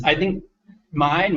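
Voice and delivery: ordinary delivery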